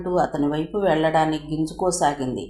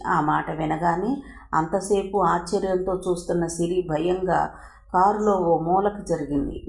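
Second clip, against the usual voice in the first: ordinary delivery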